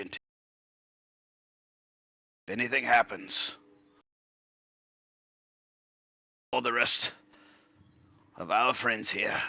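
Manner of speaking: lightly voiced old man, halting and sincere; a hint of an apologetic old gentleman; old man, earnest apology. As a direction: promise solemnly with long pauses.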